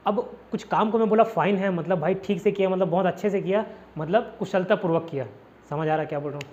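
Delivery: ordinary talk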